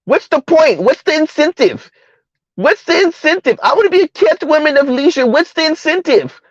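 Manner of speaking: high mocking voice, voicing the complainer; mocking women. Imitating a whiny woman he criticises, in a high mocking voice. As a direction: sneer it up high as a mock woman.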